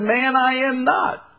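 high-pitched protest; emphatic denial. Acted. Peter answers his second accuser, emphatic denial. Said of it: "And he denied him".

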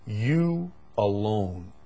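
"You alone" drawn out slowly, each word set apart; emphatic. Acted. Preacher quoting Paul to the church, slow emphasis on "You alone". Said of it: "Very emphatic. Paul says".

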